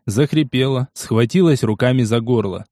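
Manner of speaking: ordinary delivery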